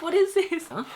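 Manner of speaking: mock-puzzled high question; surprised question. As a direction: replay her curious surprise, up high. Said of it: "I was like".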